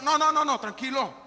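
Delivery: ordinary talk, nothing marked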